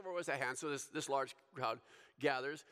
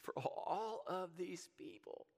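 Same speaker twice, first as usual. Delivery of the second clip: snickering tease; 4 semitones higher, 4 dB quieter than usual. Retold or imitated. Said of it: "he's kinda snickering a little bit".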